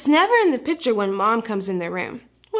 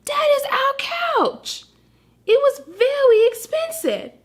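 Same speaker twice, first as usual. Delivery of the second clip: high, pleading puppy voice; a small dog begging him to stop, pitched way up; upset puppy; 13 semitones higher than usual. Acted. Frosty pleading with the Martian eating the couch, high and upset.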